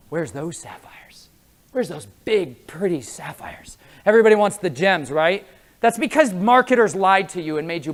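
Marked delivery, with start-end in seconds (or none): 0.1-3.8 slow unvoiced whisper of longing; greedy whisper. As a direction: whisper it like someone craving gems. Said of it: "Everybody is always like".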